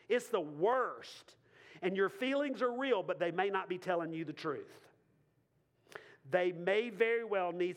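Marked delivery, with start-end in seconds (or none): 0.1-1.3 very slow, each word landed; slow it right down; slow emphatic repeat. Shown as a demonstration.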